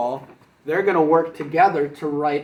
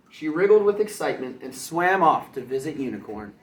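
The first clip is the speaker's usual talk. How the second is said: usual speaking voice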